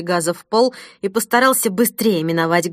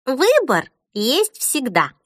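slow, higher-pitched philosophical summing-up; 5 semitones higher, slower than usual. A narrator's reading. A man of fashion sums things up, philosophical. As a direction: pronounce it slowly, as a considered maxim. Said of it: "философски резюмировал модник".

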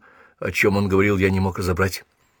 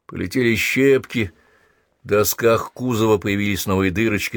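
ordinary delivery, nothing marked

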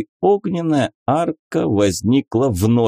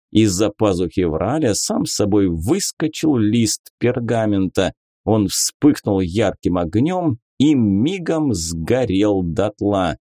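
ordinary delivery, nothing marked

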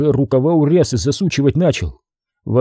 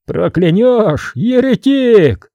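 mocking retort; 8 semitones higher, 3 dB louder, much slower than usual. A narrator's reading. Retort in quarrel.

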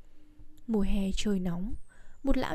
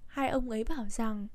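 ordinary delivery, nothing marked

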